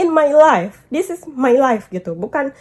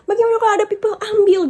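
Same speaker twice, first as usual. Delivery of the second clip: mock worried stranger; 7 semitones higher, faster than usual. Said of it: "Dia ambil gitu".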